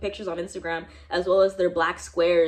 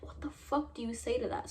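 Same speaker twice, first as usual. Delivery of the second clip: much softer, in disbelief; stunned aside; 2 semitones higher, 9 dB quieter than usual. Real, not acted. Shocked by the professor's remark, asks viewers softly in disbelief. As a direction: mutter it, still shocked.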